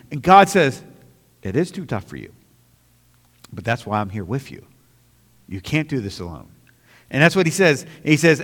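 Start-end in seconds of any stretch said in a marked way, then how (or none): 1.4-6.5 gentle voice of God. Acted. God's gentle answer voiced.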